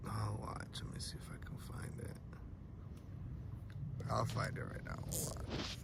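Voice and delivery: hushed whisper while searching. Real, not acted. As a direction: breathe it, fast and hushed. Streamer quietly searching for clip.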